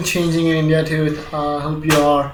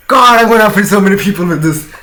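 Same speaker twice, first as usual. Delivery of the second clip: loud panicked rush; 4 semitones higher, 9 dB louder, faster than usual. Real, not acted. Panics about offending viewers.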